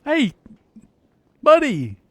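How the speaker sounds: calling out. Real, not acted. Calling the dog over.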